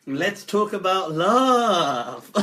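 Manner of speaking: slow, drawn-out love line; mock romantic. Teller quoting an invitation to talk about love, mock romantic. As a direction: stretch it out, hammed up.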